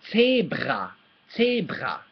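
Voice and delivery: "zebra" as TSAY-brah, uvular R; German "zebra" with ts and trilled throat R. Shown as a demonstration. Teacher modelling the R, "zebra" said TSAY-brah. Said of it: "when you say this R".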